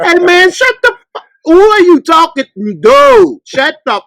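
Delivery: high, loud fed-up yell at the screen; hammed-up outburst at a TV character; exasperated telling-off. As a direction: cuss out the character in disbelief. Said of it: "I be saying".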